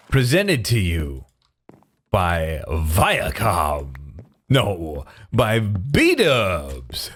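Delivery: grand showman introduction, stretched words; hammed-up presenter with swooping pitch; mock announcer. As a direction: announce it like a ringmaster, dragging out key words.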